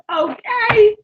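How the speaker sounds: loud laughing burst, high; big high laugh into a squeaky okay. Real, not acted.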